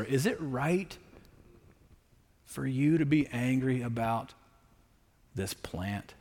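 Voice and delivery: soft, hushed gentle questioning; gentle counselor. Retold or imitated. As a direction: ask it tenderly like a caring counselor. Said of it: "the wonderful counselor".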